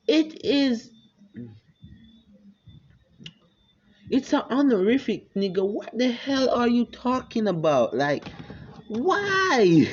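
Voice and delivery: high, exasperated what the hell; indignant protest. Acted. Naruto protesting to his father, high and exasperated. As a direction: defend himself in high disbelief.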